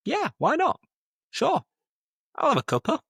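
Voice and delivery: very high-pitched, playful yes; put-on high voice, keen reply; acted eager acceptance. Acting out accepting the tea.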